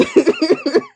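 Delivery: very high, rising squeal of laughter. Real, not acted. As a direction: squeal with glee. Laughing in disbelief.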